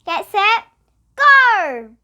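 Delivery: high loud shout of go; starter's shout. Acted. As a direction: fire off the start. Kookaburra starts race.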